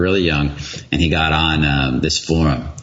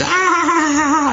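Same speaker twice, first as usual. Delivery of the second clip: mock laugh burst. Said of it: "he's laughing his ass off".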